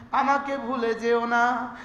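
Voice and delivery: ordinary delivery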